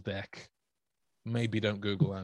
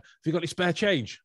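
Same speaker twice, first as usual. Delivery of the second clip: higher, louder teasing call; cheeky mock question; 7 semitones higher, 5 dB louder than usual. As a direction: call out a mock plea for spare change. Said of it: "always wants to go like".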